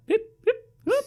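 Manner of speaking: very high imitation beeps. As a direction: yelp it in a very high voice. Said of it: "sounds like [name]".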